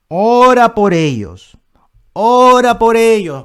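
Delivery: loud, drawn-out rising plea; fervent exhortation. Real, not acted. Preacher urging the congregation to pray for their pastors, fervent exhortation. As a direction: belt it out, stretching the first word.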